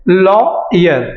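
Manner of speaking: लॉयर slowly split as law-yer; syllable-by-syllable demo. Shown as a demonstration. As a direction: pause in लॉयर before the y sound. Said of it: "हम क्या बोलते हैं". (लॉयर को धीरे-धीरे लॉ-यर बोलें)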